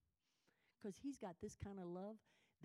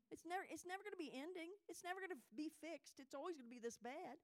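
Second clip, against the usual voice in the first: higher, whiny hopeless thoughts; self-pity voiced higher; despairing inner voice; 9 semitones higher than usual. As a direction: play your own doubt, high and defeated. Voicing her despairing thoughts.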